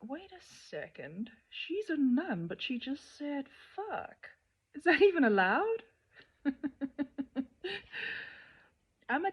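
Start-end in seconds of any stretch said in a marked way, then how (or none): 0.0-5.8 imitating a scandalised viewer, pitched higher; mock shocked viewer. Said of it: "You're also probably thinking". 6.4-8.3 a short high giggle. Real, not acted. Laughs at own joke.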